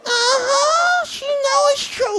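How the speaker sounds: high, loud cackling puppet voice; puppet voice shouting. Acted. Dummy gloating to the crowd, in a laughing puppet shout. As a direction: belt it out as the cheeky dummy, laughing.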